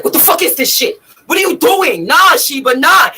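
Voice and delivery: loud, high imitation of his outrage; furious retold rant; enraged man. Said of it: "he was enraged".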